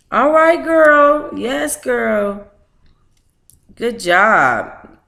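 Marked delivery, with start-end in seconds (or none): none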